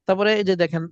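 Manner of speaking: ordinary talk, nothing marked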